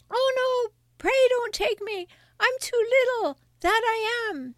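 very high, pleading little goat; small goat voice. Acted. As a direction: plead as the tiniest billy goat. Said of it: "with such a small voice".

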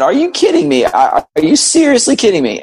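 incredulous, pitch leaping high; retold disbelief. Repeats retold disbelieving reaction.

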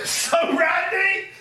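high slow laughing repeat of "So Randy"; laughing echo. Real, not acted. Teasing her word back, high and laughing. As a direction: stretch it out laughing.

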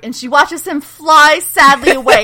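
helpless laughter breaking into speech; laughing. Real, not acted. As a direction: crack up while recapping. Cracks up recapping the scene.

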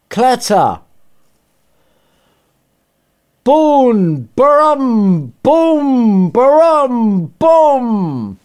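battle noises. Pretend. Narrator acting out the battle for listeners, hammed-up booms. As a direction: belt out the booms like cannon fire. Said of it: "sum it up in noises for you".

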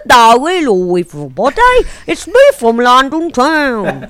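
loud, stretched-out awful mock Cockney; affected Cockney accent. Host imagining the suspect's awful accent, hammed-up Cockney. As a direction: ham up a terrible fake Cockney. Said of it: "affected Cockney accent".

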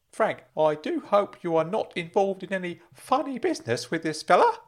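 high, admonishing uncle voice; Uncle Claude, stern concern. Acted. Uncle warns Frank off funny business, high and concerned.

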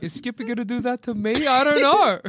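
put-on a child's worried voice; scared kid voice. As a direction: voice a nervous little kid. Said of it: "the kids are like".

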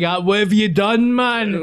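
shocked mate. Retold or imitated. Best mate reacting to the confession, aghast. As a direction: play the horrified best mate.

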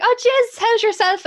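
high, loud, effusive hello; Mum's greeting. Retold or imitated. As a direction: imitate Mum hailing an acquaintance. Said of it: "Mum was like".

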